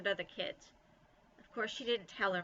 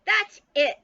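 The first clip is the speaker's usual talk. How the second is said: high, very loud shout; furious yell; 12 semitones higher, 15 dB louder than usual. A narrator's reading. Nancy yells at Bean for wiggling her behind, furious. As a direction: explode with fed-up rage. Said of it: "Nancy yelled".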